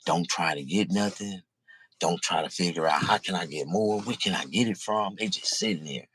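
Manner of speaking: normal talking voice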